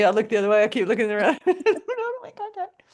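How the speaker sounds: usual speaking voice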